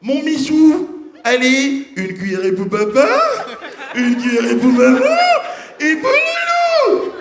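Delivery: high sing-song spoon-feeding voice; mock doting parent feeding baby; coaxing parent. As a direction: coax a toddler to eat. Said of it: "Chez les enfants d'autrui".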